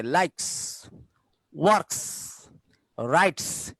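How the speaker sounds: "লাইকস", "ওয়ার্কস", "রাইটস", each ending in voiceless s. Shown as a demonstration. Teacher listing examples, each of লাইকস, ওয়ার্কস, রাইটস ending in s. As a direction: finish "লাইকস", "ওয়ার্কস", "রাইটস" on a clear sss. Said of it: "এস এর উচ্চারণটা এস এর মতো হয়".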